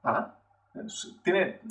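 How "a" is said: "A" rising from low; soft Mandarin rising tone on "A"; second tone. Shown as a demonstration. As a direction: lift "A" like a soft question. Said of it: "un tono que sube".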